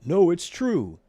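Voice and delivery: high, loud false protest; lying Fred. A narrator's reading. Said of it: "Bad Fred lied".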